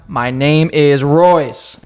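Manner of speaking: "My name is Royce" drawn right out; slow, emphatic repeat. Retold or imitated. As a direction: say each word very slowly for someone not getting it. Repeating name to uncomprehending cashier.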